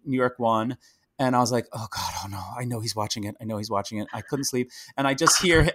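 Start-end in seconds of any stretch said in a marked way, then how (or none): 1.7-4.1 retold anxious muttering. Retelling her anxious wait in bed.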